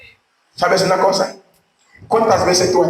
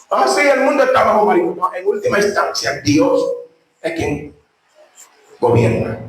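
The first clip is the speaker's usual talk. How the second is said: ordinary talk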